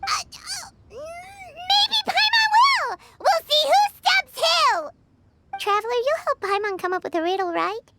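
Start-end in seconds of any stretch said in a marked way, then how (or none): 0.0-4.9 high, slow, defiant squeak; huffy little battle cry; flustered challenge. Acted. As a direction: rise to the dare all flustered. Paimon challenged, flustered retort.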